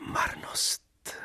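whispered, fading despair. Acted. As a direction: let the word die in a whisper. Heroine sighs out vanity. (šeptem, vyhasle)

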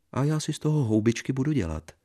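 high, fast mocking retort; mock him lightly. Wife mocks husband. (posměšně)

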